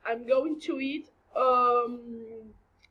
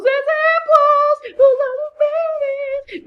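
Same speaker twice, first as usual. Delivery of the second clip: loud, drawn-out build to a high held wail; mock-grand fanfare for the next section; hammed-up announcement; 11 dB louder, much slower than usual. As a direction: stretch it out and soar way up in pitch. Hammed-up announcement of meme examples.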